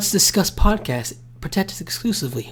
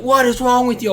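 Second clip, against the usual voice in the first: angry yell; 9 semitones higher, 5 dB louder than usual. Retold or imitated. Retold yelling at fighting friends.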